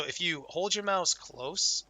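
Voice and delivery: normal talking voice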